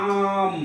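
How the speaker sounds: আম with the opening "aa" held long; stressed vowel sound. Shown as a demonstration. Teacher showing umbrella's first sound, drawn-out আম. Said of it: "আ উচ্চারণটা".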